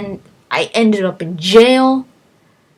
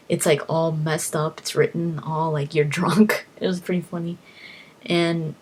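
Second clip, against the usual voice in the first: ordinary talk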